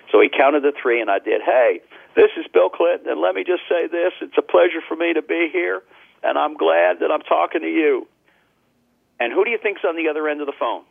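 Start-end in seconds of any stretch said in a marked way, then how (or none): 1.4-8.1 Bill Clinton impression. Impressionist greets the caller on the phone as Bill Clinton, in a Clinton impression. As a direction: slip into Clinton's voice for the phone listener. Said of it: "do your Bill Clinton impersonation".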